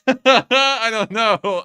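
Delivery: high, loud and slow through laughter; laughing. Real, not acted. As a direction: laugh it out, slowly. Laughing at the odd donation.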